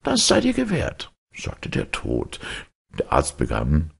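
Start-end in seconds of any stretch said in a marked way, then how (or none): 0.0-1.1 kindly Death, voiced much higher; Death's voice. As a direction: speak as gentle Death, pitched up. Death grants the wish.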